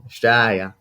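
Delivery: broad Australian Australia, vowels open and flat; Australian accent. Shown as a demonstration. Guest shows how he says his birth country, broad Australian. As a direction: say Australia as an Aussie would, Austral-ya. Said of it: "so I say it differently".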